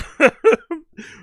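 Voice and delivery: sudden high burst of laughter; loud high laugh. Real, not acted. Laughing hard before he reads the chat.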